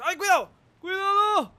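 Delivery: high startled warning; alarmed cry. Real, not acted. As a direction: yelp it in alarm. Nearly crashing bike.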